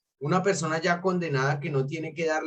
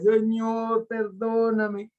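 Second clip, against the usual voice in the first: higher, very slow plea, "perdóname" drawn out; pleading prayer; 4 semitones higher, much slower than usual. Retold or imitated. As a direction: cry out to the Lord, stretching "perdóname". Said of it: "me tocó arrodillarme otra vez". (súplica aguda y muy lenta, alargando "perdóname")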